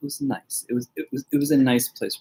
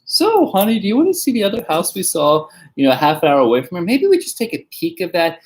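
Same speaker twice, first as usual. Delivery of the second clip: high, fast cajoling pitch; husband steering wife away from signing; coaxing husband; 4 semitones higher, 3 dB louder than usual. Retold or imitated. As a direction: sweet-talk her toward the other house, quickly. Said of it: "I was like".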